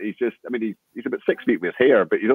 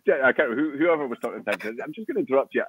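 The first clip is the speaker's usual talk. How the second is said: normal talking voice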